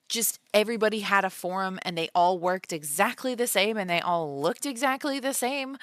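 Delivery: usual speaking voice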